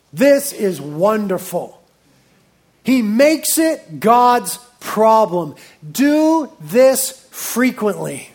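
ordinary delivery

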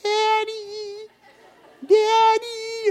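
put-on very high, sing-song little girl call; high mock toddler yell for Daddy. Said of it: "she also yells for Daddy".

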